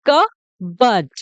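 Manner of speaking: "कबर्ड" in two slow beats, kuh-burd; correct pronunciation. Shown as a demonstration. Teacher after explaining the silent letter, slow "कबर्ड" without P. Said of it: "जो पी है वो silent रहेगा".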